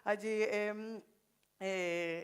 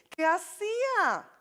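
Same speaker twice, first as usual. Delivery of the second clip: rhetorical challenge; 6 semitones higher, 4 dB louder, slower than usual. Real, not acted.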